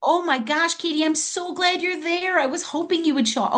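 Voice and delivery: light up as Katie joins; excited greeting. Real, not acted. Greeting Katie arriving on call.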